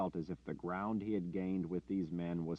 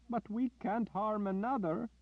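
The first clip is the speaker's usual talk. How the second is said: high pleading whine, much higher; whining; 4 dB louder than usual. Acted. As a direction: whine it like a frightened man. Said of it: "Owen whined".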